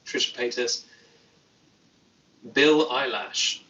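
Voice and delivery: usual speaking voice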